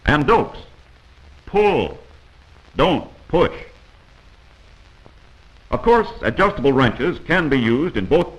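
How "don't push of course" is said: ordinary talk, nothing marked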